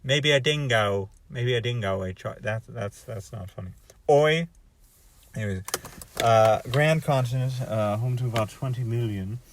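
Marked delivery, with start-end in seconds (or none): 4.1-4.5 Australian oi. Retold or imitated. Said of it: "Australian oi".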